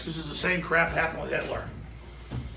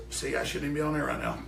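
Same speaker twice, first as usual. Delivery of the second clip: usual speaking voice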